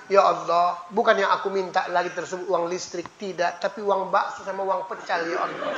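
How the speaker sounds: soft, low, sheepish plea; sheepish prayer. Retold or imitated. (doa malu-malu yang lirih)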